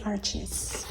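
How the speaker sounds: "arches" in two syllables with a ch sound; English-style pronunciation. Shown as a demonstration. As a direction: settle on "arches" as AR-chiz. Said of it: "the English-speaking world would say arches".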